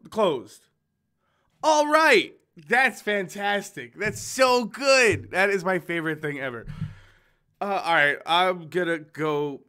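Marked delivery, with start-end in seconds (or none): 1.6-6.7 loud, high, drawn-out mock enthusiasm; sarcastic delight. Real, not acted. Player reacting to the game crashing, sarcastic delight. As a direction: overdo the praise, slow and loud.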